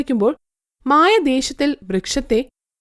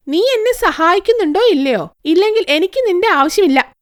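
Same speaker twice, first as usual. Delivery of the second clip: put-on demanding girl; 6 semitones higher than usual. Girl threatens the tree.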